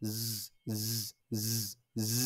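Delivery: "জ" as separate long buzzes, no vowel; Z sound drill. Shown as a demonstration. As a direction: repeat "জ" as a sustained zzz. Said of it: "আমি আরেকবার বলে দিচ্ছি".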